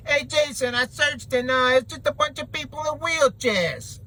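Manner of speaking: high-pitched put-on caller voice; mock viewer voice. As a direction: imitate a clueless viewer in a silly high voice. Mimicking viewers in a retold complaint.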